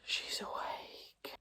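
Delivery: slow hushed whisper. Real, not acted. Mother whispering that the baby woke.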